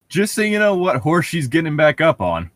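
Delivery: usual speaking voice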